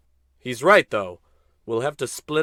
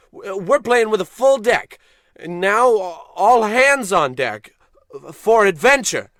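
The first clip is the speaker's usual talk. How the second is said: rousing rally; 5 semitones higher, 6 dB louder than usual. Acted. Rousing speech to crew.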